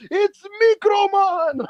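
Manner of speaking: very high, slow, sung-out call; hammed-up high announcer voice, stretched out; mock announcement. Announcing a tiny wrestler running out, high drawn-out mock call.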